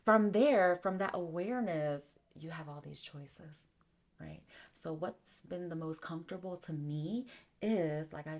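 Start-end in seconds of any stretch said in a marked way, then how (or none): none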